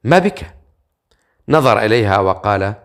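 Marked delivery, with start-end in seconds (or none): 0.0-0.6 concerned question lifted much higher; worried. A narrator's reading. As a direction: ask anxiously, pitch jumping up. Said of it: "بقلق،".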